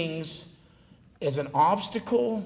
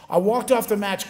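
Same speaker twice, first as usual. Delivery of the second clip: ordinary delivery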